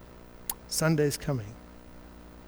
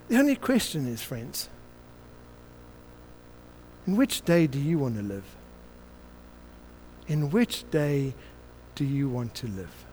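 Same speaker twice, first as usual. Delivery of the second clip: quiet appeal; 4 semitones lower, 10 dB quieter than usual. Acted.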